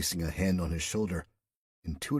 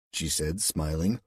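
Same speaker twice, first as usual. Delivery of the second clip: usual speaking voice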